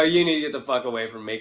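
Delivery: low, soft and fast threat; comic cutting off a heckler, low quick threat; low menacing warning. Retold or imitated. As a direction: snap it off quick and cold. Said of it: "I go".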